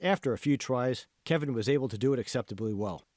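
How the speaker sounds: ordinary delivery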